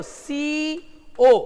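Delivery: सीओ stretched very slowly; drawn-out pronunciation. Shown as a demonstration. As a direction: read सीओ out long and slow. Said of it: "पढ़ के देख लो". (सीओ को बहुत धीरे खींचकर)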